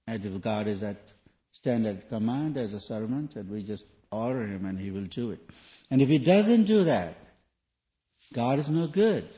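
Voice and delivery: normal talking voice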